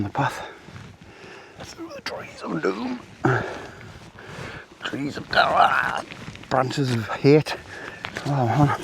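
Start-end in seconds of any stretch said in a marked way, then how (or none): 1.6-7.6 theatrical dread, drawn out; mock spooky. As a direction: play the woods like a horror trailer. Mock ominous woodland commentary.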